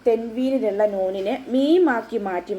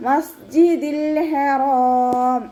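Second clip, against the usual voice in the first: slow, higher chanted മസ്ജിദിൽ with the l kept; correct recitation; 5 semitones higher, 4 dB louder, much slower than usual. Shown as a demonstration. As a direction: chant മസ്ജിദിൽ ഹറാം fully and slowly. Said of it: "അതുപോലെ ഓതുക".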